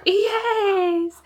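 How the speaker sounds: high squeal with a laugh. Real, not acted. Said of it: "talk to the dogs like a baby".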